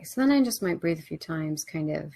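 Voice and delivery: ordinary talk, nothing marked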